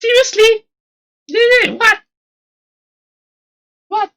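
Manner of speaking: high, loud cry of disbelief; stunned disbelief. Real, not acted. Streamer reacting to Nene's shocking request, stunned disbelief. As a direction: react like you can't believe what you just heard.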